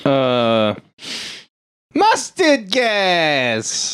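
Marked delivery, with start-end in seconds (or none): 0.1-2.7 drawn-out battle cry. Acted. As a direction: stretch it out like a buzzer cry. Buzzing in with a catchphrase.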